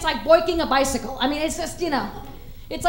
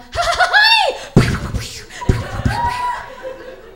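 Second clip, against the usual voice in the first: high cackling laugh; 5 dB louder than usual. Real, not acted. Laughing at own joke.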